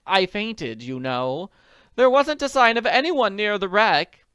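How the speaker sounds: worried wealthy woman; anxious society lady. Acted. Willoughby voice.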